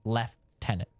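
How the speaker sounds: "lieutenant" broken up as "lef... tenant"; mock the F in "lieutenant": lef, then tenant; British lef-TEN-ant. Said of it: "There's no F in lieutenant".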